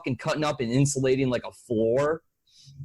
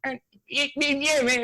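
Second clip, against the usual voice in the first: high, garbled mock muttering; Jason; 10 semitones higher, faster than usual. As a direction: mumble off high like a tweaker backing down. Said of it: "And Jason's like".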